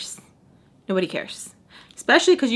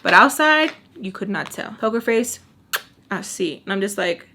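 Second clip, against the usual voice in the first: normal talking voice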